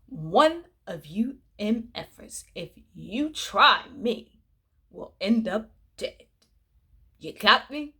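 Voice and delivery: slow, menacing mother voice; lightly voiced threat from a stern mother; mock threat. Vlogger voicing the mother's unspoken threat to the kids, slow and menacing. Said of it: "what she wanted to say".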